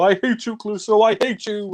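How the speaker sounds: mock maniac crying I hate you; crazed Dreyfus imitation. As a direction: play a man driven mad, spitting it out high. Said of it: "He's like, you know".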